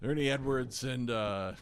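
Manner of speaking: soft, low, halting storytelling; low-energy old singer. Retold or imitated. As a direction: imitate a fading elderly performer rambling. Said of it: "like, low energy".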